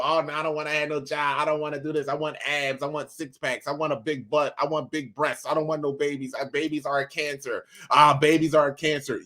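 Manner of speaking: lightly voiced mock woman refusing babies; mocking woman's voice. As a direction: voice a dismissive woman in mockery, lightly. Mocking women avoiding babies.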